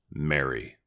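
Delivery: lovingly calling "Mary." softly; tender, soft name call. Shown as a demonstration. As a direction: say "Mary." gently, as Jesus called her by name. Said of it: "in this way that Jesus said to her".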